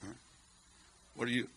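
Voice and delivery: ordinary delivery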